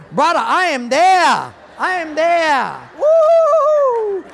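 mock eager shout. Suddenly eager for golf.

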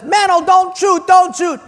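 put-on high, loud pleading girlfriend; begging him not to shoot, hammed up; mock panicked plea. Ex-girlfriend begs Mano not to shoot, mock panic. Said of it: "his ex-girlfriend says".

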